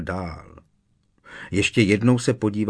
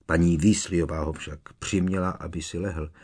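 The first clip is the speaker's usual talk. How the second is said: usual speaking voice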